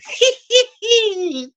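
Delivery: very high, squealing cackle; Crypt Keeper cackle. Retold or imitated. Host closing the horror episode, high mock cackle. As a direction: cackle in a thin falsetto.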